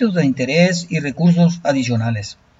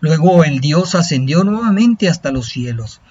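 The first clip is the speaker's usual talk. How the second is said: epic fantasy narrator; 3 semitones higher, 3 dB louder than usual. A narrator's reading. Narrates an Aztec legend.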